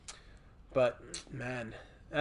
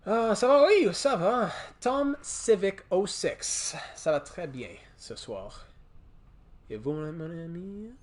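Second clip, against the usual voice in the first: English speaker's clumsy French; playful French, English accent; foreign accent in French; 4 semitones higher, slower than usual. Pretend. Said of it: "trying to speak French while reading comments".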